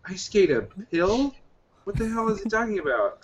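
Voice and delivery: mock confusion; baffled. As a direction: ask it like you misheard something absurd. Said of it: "I was like".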